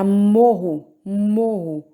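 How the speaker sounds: "morro" with a loud, throaty double R; strong R. Shown as a demonstration. Teacher contrasting "morro" with "moro", emphatic. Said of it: "É diferente de moro".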